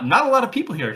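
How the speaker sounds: normal talking voice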